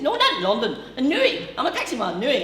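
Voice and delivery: high, loud indignant correction; hammed-up Newry taxi man; manic Newry man. Taxi man correcting the comedian about Newry, high and loud. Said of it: "He says".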